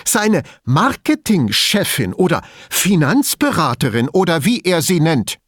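high, emphatic, contemptuous listing; scornful anger. A narrator's reading. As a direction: toss out her titles with angry contempt. Elisabetta's barely concealed anger at rival.

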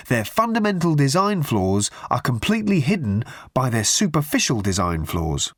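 ordinary delivery

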